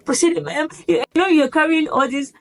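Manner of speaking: high strained drill reply; recruit reply. Retold or imitated. Recruit carrying heavy bags answers sergeant, high strained. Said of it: "we're like".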